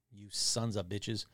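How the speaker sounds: low, soft and slow curse; muttered grumble. Real, not acted. Podcaster cursing the blocked article, muttered grumble. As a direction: grumble it slowly under your breath.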